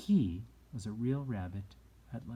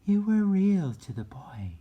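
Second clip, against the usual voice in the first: high, loud, bright fairy voice; high sweet fairy speaking to the rabbit; 10 semitones higher, 10 dB louder than usual. A narrator's reading. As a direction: lift it way up into a fairy's voice. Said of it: "the fairy said".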